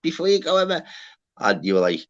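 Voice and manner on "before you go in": high, squeaky mock impression of Jay; imitating Jay. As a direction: pitch it way up, teasing her urgency. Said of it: "she was like".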